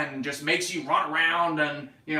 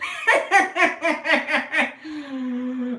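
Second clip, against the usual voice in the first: high giggly laugh; 11 semitones higher, 5 dB louder than usual. Real, not acted. Laughs after joke.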